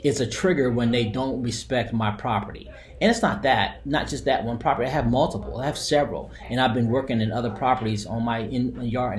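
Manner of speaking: ordinary talk, nothing marked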